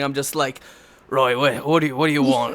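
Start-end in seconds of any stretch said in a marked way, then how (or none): 1.1-2.6 gravelly Cockney guard or bartender; low, gruff Cockney accent. Shown as a demonstration. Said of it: "gruff, gravelly Cockney accent".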